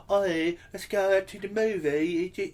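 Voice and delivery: high, slow, hammed-up Cockney accent; Cockney girl. Mocking a Cockney girl asking him out, slow high Cockney accent. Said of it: "it's not like a Cockney accent".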